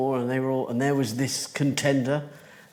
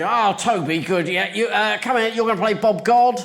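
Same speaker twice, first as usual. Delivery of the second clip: louder, higher calling-out; busy director shouting out casting; bossy director; 6 semitones higher, 5 dB louder than usual. Retold or imitated. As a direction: briskly summon an actor and hand out a part. Director briskly casting actors in anecdote.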